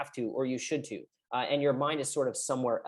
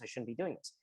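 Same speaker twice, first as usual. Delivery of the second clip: much softer, nearly under the breath; fading inner critic; 4 semitones lower, 11 dB quieter than usual. Pretend. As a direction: let the guilty thought sink almost to nothing. Inner critic beating yourself up.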